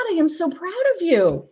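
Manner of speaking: ordinary talk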